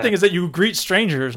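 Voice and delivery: normal talking voice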